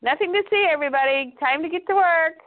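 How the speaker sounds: high, mock-casual call to the office; lightly acted all-is-normal announcement; breezy announcement. Impostor waves off the chaos to staff.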